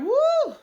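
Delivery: high excited whoop. Retold or imitated. Cheering uncle's speech, high excited whoop. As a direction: whoop like you're in church.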